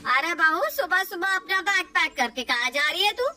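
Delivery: a mild hint of a sharp saas; questioning mother-in-law.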